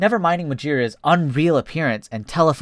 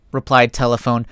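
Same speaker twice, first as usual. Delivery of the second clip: plain reading voice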